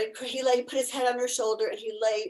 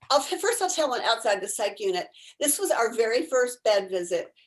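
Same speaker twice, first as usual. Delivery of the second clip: ordinary talk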